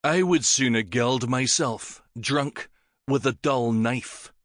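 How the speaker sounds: slow, each word weighted; slow, deadly emphasis. Acted. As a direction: lay a lethal weight on every word. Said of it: "Syn spoke with a slow, deadly emphasis on each word".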